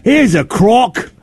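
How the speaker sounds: high, slow mock Aussie accent; Australian accent. Dad jokes about a cheese crock in a mock Australian accent. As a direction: put on a hammed-up Australian accent. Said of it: "you doing that accent".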